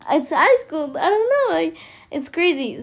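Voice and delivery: high, loud, giggly outburst; laughing exasperation. Real, not acted. Telling listeners how crazy high school is, giddy and exasperated. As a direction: voice shoots up in giddy disbelief. Recalling nerves about first high school day.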